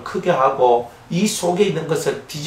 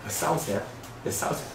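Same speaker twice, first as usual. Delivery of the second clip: much softer, lower, timid question; timid Seoulite; 6 semitones lower, 12 dB quieter than usual. Retold or imitated. A scared Seoul newcomer asking the locals, timid and soft. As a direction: shrink back as you ask. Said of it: "이렇게 쫄아요".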